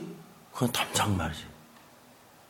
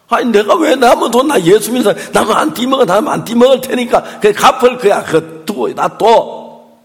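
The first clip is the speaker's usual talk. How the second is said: loud, high acted argument; retold insistent protest; 6 semitones higher, 7 dB louder than usual. Believing wife swearing she will pay the collector, loudly insistent. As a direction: insist at raised pitch and volume.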